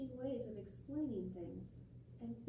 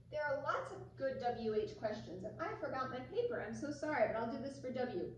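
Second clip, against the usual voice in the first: ordinary delivery, nothing marked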